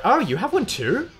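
high, drawn-out mock gushing; hammed-up retold surprise, slowing down; mocking barista. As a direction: imitate a condescending barista, swooping up high. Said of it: "And they were like".

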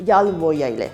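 ordinary talk

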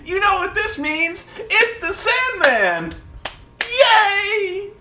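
put-on very high, squealing little-boy glee; mock overjoyed kid, stretching out the cheer; Mike Tenay. Host mocks an announcer awaiting his favourite wrestler, squeaky overjoyed impression. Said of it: "He was so happy".

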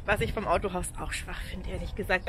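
ordinary delivery

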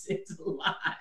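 breathy whispered echo of the line; whispered repeat. Real, not acted. Dad worn out by parenting, whispering it again. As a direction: let it fade into a whisper.

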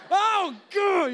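exasperated groan. Retold or imitated. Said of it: "It's like".

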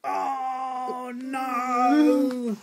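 high fed-up wail; exasperated dad. Acted. Sleepless dad at his wits' end, high wailing cry. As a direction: wail it like a worn-out father. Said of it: "And said".

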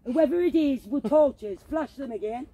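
loud high challenge; calling out. Real, not acted. Daring whoever has torches to flash again, loud call. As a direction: dare them to show themselves.

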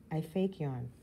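plain reading voice

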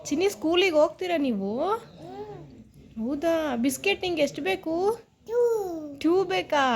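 high singsong motherese; mother asking her little daughter questions, high and singsong. Real, not acted. Mother coaxing toddler.

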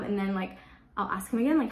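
ordinary delivery

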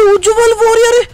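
high, loud frantic complaints; stuck and scared, shouting; panicked yelling. Real, not acted. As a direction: yell in frantic dismay. Trapped, enemy joined.

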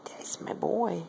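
hushed pet praise; whispered praise. Real, not acted. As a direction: praise him in a hush. Praising the cat.

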